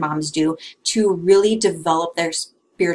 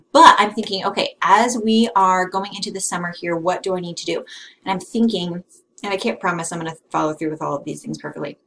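usual speaking voice

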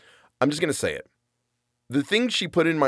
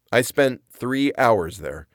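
usual speaking voice

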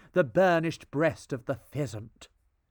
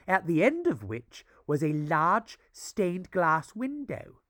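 ordinary delivery, nothing marked

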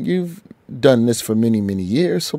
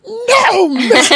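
a lightly voiced fed-up soft-spoken woman, much higher; imitating Hooks; 5 dB louder, slower than usual. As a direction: mimic a timid woman finally snapping. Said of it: "that lady Hooks ... soft-spoken".

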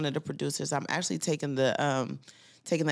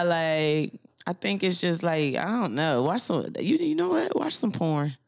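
ordinary talk, nothing marked

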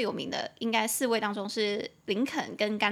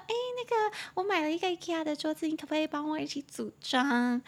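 high, softened pleading voice; coy request; 6 semitones higher than usual. Acted. Asking a crush to help assemble furniture, coy. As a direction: act out a shy favor-asking, pitched well up.

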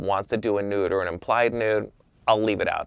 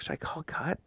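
soft, higher questioning undertone; hushed self-questioning; 7 semitones higher, 10 dB quieter than usual. Retold or imitated. Photographer wondering to himself mid-take, hushed question. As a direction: quietly wonder aloud to yourself. Wonders privately while camera rolls.

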